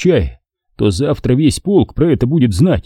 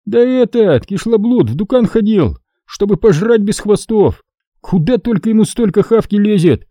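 a raised voice mocking a greedy comrade; Hasan pressing on; 10 semitones higher than usual. A narrator's reading. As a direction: keep jabbing with contempt. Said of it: "продолжал напирать Хасан".

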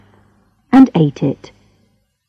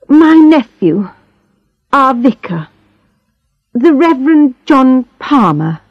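tired voice; 6 semitones higher than usual. Acted. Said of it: "she explained in a tired voice".

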